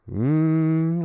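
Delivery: loud, high doubtful mm; a big unconvinced mmm; sceptical hum. Real, not acted. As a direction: hum your disbelief. Scoffing at a humble prediction.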